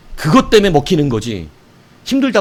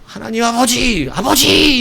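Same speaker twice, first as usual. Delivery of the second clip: high, slow calling out to God; proper cry to Father; 9 semitones higher, slower than usual. Shown as a demonstration. How prayer should sound, high slow calling cry. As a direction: reach up and call Father. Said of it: "이렇게 들려야 되는데".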